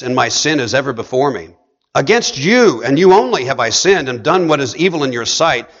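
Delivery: pleading with God in solemn scripture; scripture reading. Acted. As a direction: pray the words out over the room. Reads Psalm 51 aloud, intoned.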